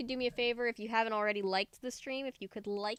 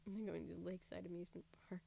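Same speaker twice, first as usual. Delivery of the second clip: whispering; 4 semitones lower, 14 dB quieter, much faster than usual. Real, not acted. Aside to chat, hushed.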